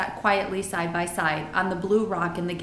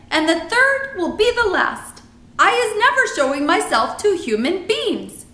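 exasperated giant, higher and louder; BFG; 11 semitones higher, 8 dB louder than usual. A narrator's reading. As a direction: lay down the law as a friendly giant.

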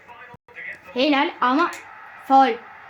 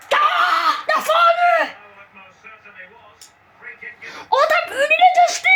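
much higher, laughing outraged yell; outraged shout; 13 semitones higher than usual. Real, not acted. Gamer protesting the referee's foul call, outraged shout. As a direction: shout down the ref's call in disbelief.

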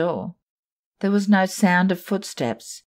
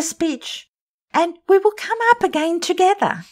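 put-on high, louder young girl's voice; frightened girl; 12 semitones higher, 4 dB louder than usual. Germaine urges the girls to go down together, high and scared. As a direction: urge the others, scared.

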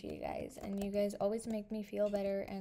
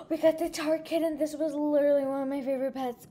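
thrilled; 5 semitones higher, 9 dB louder, faster than usual. Real, not acted. Got her favorite pet.

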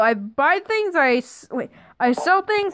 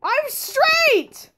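high-pitched, offended outcry; indignant protest; 9 semitones higher than usual. Pretend. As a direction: protest the insult in a high wail. Indignant answer to a teasing insult.

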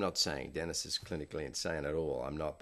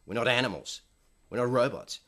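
louder and higher, protesting hard; building angry rant; 5 semitones higher, 6 dB louder, faster than usual. Retold or imitated. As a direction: mimic Dennis yelling that actors aren't objects. Dennis ranting at the director.

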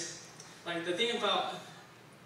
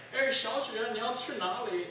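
higher, brisk Mandarin driver voice; Chinese driver; 7 semitones higher than usual. Retold or imitated. Cab driver asking mom where to, Mandarin-speaking driver. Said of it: "The driver goes like".

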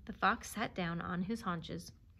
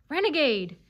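high, loud insult; snarled accusation; 7 semitones higher, 9 dB louder than usual. A narrator's reading. Ferret denouncing the dog, snarling. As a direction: fling the word out angrily. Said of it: "snarled the ferret".

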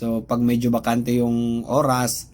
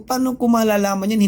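retorting; 7 semitones higher, 3 dB louder than usual. Retold or imitated. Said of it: "yung tono ng boses ko, mataas na rin".

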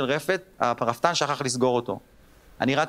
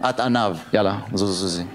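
tired mom; 7 semitones lower than usual. Retold or imitated. Mom dismissing daughter.

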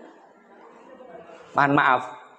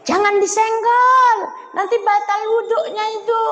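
put-on fussy old auntie; 13 semitones higher than usual. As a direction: squeak it out like a busybody mourner. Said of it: "aku palak nengok mamak-mamak".

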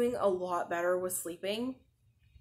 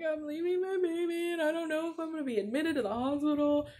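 higher, fast, half-crying retelling; scared mother leaving her newborn, tearful; tearful quote; 8 semitones higher, faster than usual. Retold or imitated. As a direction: relive the tearful panic, rushed and high. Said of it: "I'm, like, in tears on the way".

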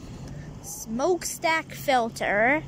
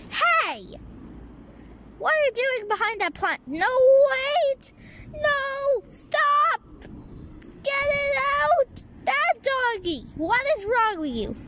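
high scolding; 10 semitones higher than usual. Acted. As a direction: shriek at the bad dog. Scolding the misbehaving dog.

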